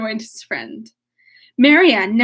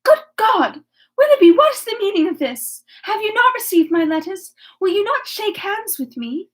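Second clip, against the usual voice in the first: high-pitched, impassioned pleading; greatest emotion; 8 semitones higher than usual. A narrator's reading. Marianne confronting Willoughby at the party, greatly agitated. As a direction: cry out in high, agitated disbelief. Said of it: "exclaimed in a voice of the greatest emotion".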